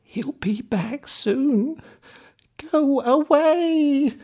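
put-on old woman at the door urging Jack to leave, rising high and loud; anxious old woman. A narrator's reading. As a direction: shoo the boy off, slow and urgent.

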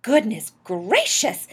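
loud, drawn-out amazement; astonished exclamation. A narrator's reading. Wife hearing husband's name on the radio, astonished. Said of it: "exclaimed Missus Popper".

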